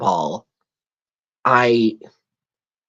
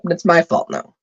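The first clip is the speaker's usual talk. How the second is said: ordinary talk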